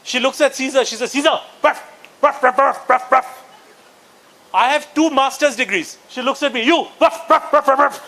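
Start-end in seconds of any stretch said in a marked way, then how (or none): 1.1-3.4 brusque name, then repeated ruff noises; imitated dinner call for dog. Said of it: "This is how she calls Caesar for dinner". 6.6-8.0 curt summons with repeated ruff noises; imitated dog-style dinner call. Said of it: "she calls me and the dog the same way for dinner".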